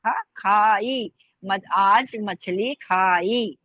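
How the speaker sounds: खाई with an aspirated ख, drawn out slowly. Shown as a demonstration. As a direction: stretch आज मछली खाई with a puff on ख. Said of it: "खा भारी खा आएगा". (धीरे, भारी ख के साथ मछली खाई)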